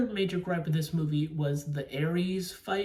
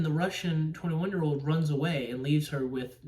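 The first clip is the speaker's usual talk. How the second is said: ordinary delivery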